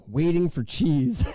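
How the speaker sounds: ordinary delivery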